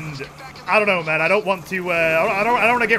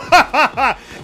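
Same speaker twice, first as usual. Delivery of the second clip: loud laughter in a raised pitch; 5 semitones higher, 6 dB louder than usual. Pretend. Streamer cracking up over the wrong ingredient, loud laughter. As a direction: burst out laughing loudly. Laughs after mock protest.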